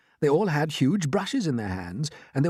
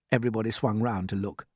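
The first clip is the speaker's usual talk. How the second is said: usual speaking voice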